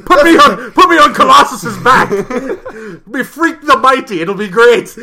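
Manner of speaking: loud, high, hammed-up Scottish voice; Scottish accent. Amped-up character begging to be carried into battle, mock Scottish. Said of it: "Why is he Scottish?".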